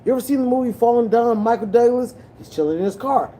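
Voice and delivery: ordinary delivery, nothing marked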